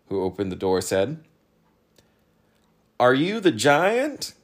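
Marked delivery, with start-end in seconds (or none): 3.0-4.3 high, drawn-out questioning voice; slow, loud, sceptical question; doubtful. A narrator's reading. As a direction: ask it slowly, rising in disbelief. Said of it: "asked Talk doubtfully".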